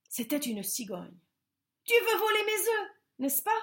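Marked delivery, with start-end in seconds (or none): 1.8-3.5 higher, louder accusation; accusing stork. A narrator's reading. Stork accusing the bear of stealing eggs, higher. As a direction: accuse him.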